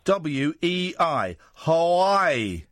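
letter by letter, slowly, as if to a foreigner; slow, deliberate spelling lesson. Shown as a demonstration. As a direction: spell it slowly, then draw out 'Hawaii'. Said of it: "Spell it".

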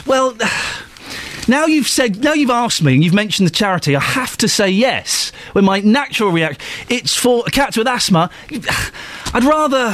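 high-pitched, sighing reluctance; mock exasperation. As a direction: act trapped into a grudging yes. Acted exasperated reply to charity email.

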